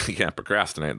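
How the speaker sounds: ordinary talk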